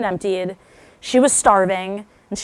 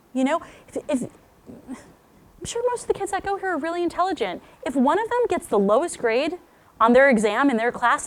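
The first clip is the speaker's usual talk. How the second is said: usual speaking voice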